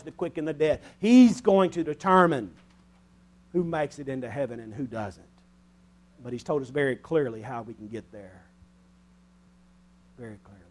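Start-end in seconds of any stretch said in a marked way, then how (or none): none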